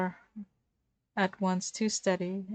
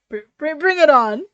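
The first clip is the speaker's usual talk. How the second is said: loud, higher mock Techno challenge; Techno imitation; 7 semitones higher, 13 dB louder than usual. Ranboo imitating Techno, loud and higher. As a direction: puff up and copy Techno's tone. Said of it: "in his best imitation of Techno's tone".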